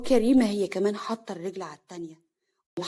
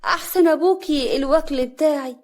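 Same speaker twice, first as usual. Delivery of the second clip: louder, higher, pointed announcement; raised voice; 5 semitones higher, 5 dB louder than usual. Acted. Mother announcing that only she feeds him, loudly. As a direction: speak up so the others hear. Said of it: "وبعدين علت صوتها".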